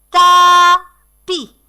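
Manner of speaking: "tapis" loud and high, split into ta, pis; scanned syllables. Shown as a demonstration. Teacher scanning "tapis" for the children, syllable by syllable. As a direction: call out "tapis" in two separate beats.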